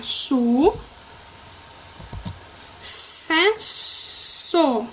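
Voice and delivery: su, long pause, then cesso as ces, so; syllable by syllable. Shown as a demonstration. Teacher separating the word, su, cesso in three slow pieces. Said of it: "eu vou separar".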